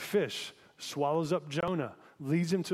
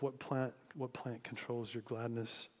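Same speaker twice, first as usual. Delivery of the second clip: quiet repeat; 7 dB quieter than usual. Real, not acted. Repeating the key question softly.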